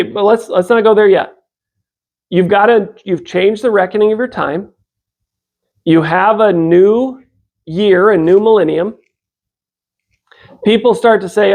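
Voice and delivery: ordinary talk, nothing marked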